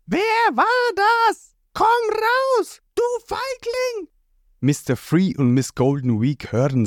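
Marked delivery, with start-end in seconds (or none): 0.1-4.1 very high, strained yell at a hidden foe; roaring challenge. A narrator's reading. Trapped Ruffy yells at the unseen attacker, high furious shout. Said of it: "brüllt".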